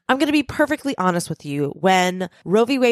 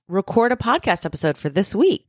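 ordinary talk, nothing marked

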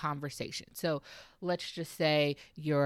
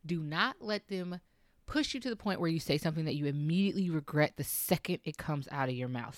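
ordinary delivery

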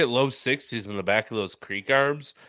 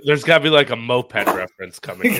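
loud, half-laughing aside; loud retold thought; 10 dB louder, faster than usual. Cohost loudly predicting a moped joke, loud. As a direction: call it out like you saw the joke coming. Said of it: "I was like".

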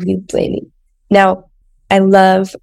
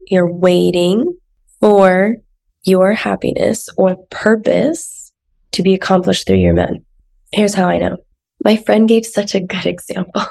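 normal talking voice

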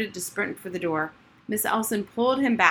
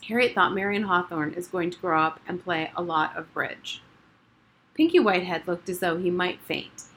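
ordinary delivery

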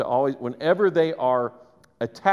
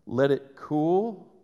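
slow advice; much slower than usual. Shown as a demonstration. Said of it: "preaching patience".

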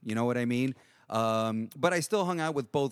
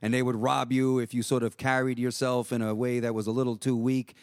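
ordinary talk, nothing marked

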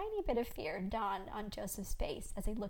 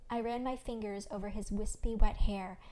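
ordinary delivery

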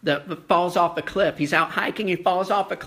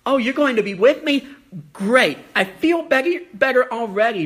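higher, louder, quick eager relief; mock relief; 5 semitones higher, 4 dB louder than usual. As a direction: play Moses suddenly cheered up. Moses mock-relieved reply to God.

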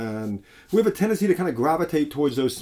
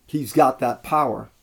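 usual speaking voice

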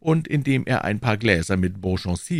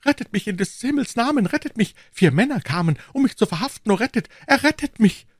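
very high, frantic begging; panicked cry; 3 dB louder than usual. A narrator's reading. Shopkeeper begging musketeers to save him from arrest, panicked. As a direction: plead desperately in a high voice. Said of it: "schrie er".

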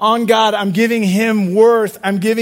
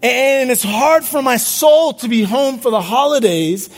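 higher, slow, impassioned preaching; building sermon climax; preaching at full force; 5 semitones higher than usual. Acted. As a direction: lift the congregation with rising urgency. Preaching climbs in pitch.

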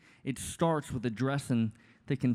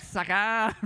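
mocking jeer; 4 semitones higher than usual. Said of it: "running like".

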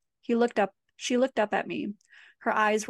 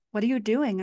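usual speaking voice

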